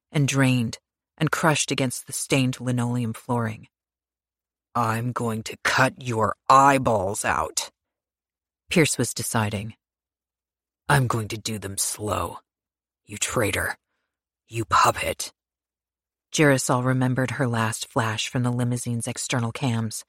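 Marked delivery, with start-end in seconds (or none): 4.7-7.7 slow menacing threat. Acted. Pierce threatens her with knife. 10.9-15.3 lower, cold, deliberate menace; low menacing threat. Acted. Pierce threatens her with knife.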